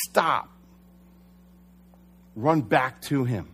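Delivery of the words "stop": raised, emphatic one-word command; retold command from God. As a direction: call the halt out suddenly. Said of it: "if you hear God saying".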